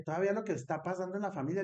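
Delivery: high, quick scolding rant; fast high scolding of the cousin; retold scolding. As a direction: scold him fast and loud.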